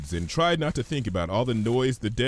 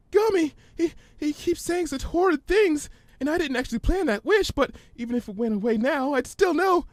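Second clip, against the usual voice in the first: much higher, fast and breathless; anxious rush. Acted. Fluttershy pours out her fears to Twilight, rushed high. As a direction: tumble out in a panic.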